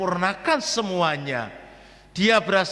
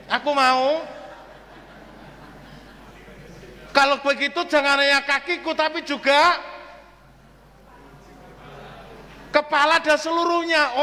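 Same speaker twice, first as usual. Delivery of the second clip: higher, eager Peter asking for more; 7 semitones higher than usual. Retold or imitated. Peter asks Jesus to wash his head too, higher and eager. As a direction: overdo Peter's sudden zeal. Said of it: "nanti didramatisir juga begitu".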